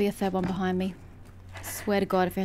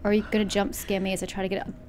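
ordinary delivery

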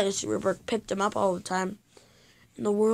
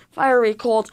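plain reading voice

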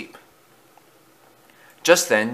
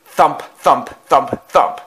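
heavy, punched thumps, louder and higher; thumping noise; 4 semitones higher, 5 dB louder, slower than usual. A narrator's reading. Noise comes closer to Thomas, loud punched thumps. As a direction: hit each thump like a blow.

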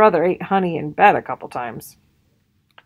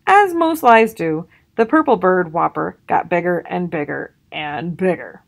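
ordinary delivery, nothing marked

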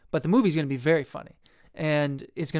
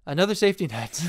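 ordinary talk, nothing marked

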